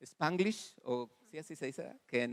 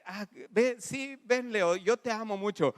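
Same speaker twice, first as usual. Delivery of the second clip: louder, higher hammed-up gushing; mock gushing love confession; 6 semitones higher, 4 dB louder than usual. Pastor mocking a love confession to a friend, hammed-up gushing. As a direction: ham it up like a sappy lover. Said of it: "O incluso puedo decir".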